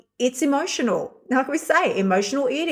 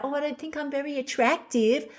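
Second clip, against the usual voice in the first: higher, softer, airy mock whine; mock vain self-doubt; 6 semitones higher, 4 dB quieter than usual. Mocking a woman with only surface self-doubt, higher and softer. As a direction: toss it off like a trivial worry.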